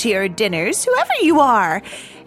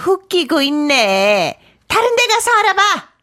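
quick, scornful brush-off; dismissive; 7 semitones higher, much faster than usual. Acted. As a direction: send them away with fast contempt. Nursemaid dismisses the children.

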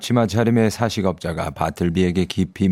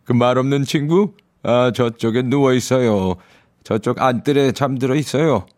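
higher, brisk guard voice; offhand jailer pointing across the yard; prison guard; 5 semitones higher than usual. Acted. Guard answers narrator's question.

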